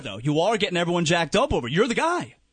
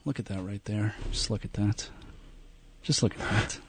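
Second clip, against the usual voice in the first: low, hushed, reverent whisper; whispered serious voice; 7 semitones lower, 9 dB quieter than usual. Acted. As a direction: breathe it out in awe. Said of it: "Why do you go to whispered tone?".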